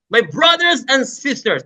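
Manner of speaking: high, projected call to an open crowd; crusade shout. Shown as a demonstration. Showing how to address an open crusade crowd, high shouted call. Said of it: "Kapag open crowd crusade po yan".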